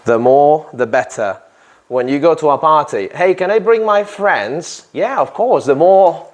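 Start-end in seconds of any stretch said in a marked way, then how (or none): 3.1-4.9 bright, higher friendly question; teacher voicing a party guest, higher and eager; party guest asking. 4.9-6.2 higher, cheerful reply; teacher voicing the host, higher and welcoming. Teacher acts out host answering.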